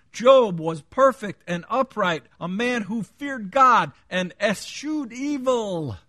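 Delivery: high, loud scripture proclamation; forceful preaching. Real, not acted. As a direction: hammer out each verse with drawn-out emphasis.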